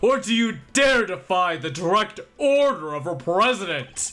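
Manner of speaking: high, loud imperious command; bombastic henchman. Acted. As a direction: dismiss them with theatrical bombast. Acted politician ordering prosecutor away.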